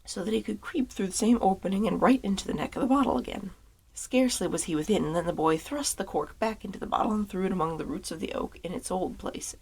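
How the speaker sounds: ordinary delivery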